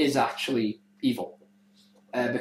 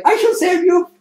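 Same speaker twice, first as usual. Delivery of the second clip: very high, very loud hammy hero cry; heroic proclamation; 10 dB louder than usual. Retold or imitated. Assassin attacking the sister, hammy high heroic shout. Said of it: "infatuated with, like".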